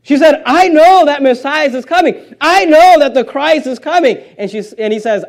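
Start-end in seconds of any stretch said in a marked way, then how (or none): 0.4-4.2 high, raised, insistent preaching; emphatic declaration. Acted. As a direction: proclaim it with certainty, pitched up. Acts out woman's insistent declaration.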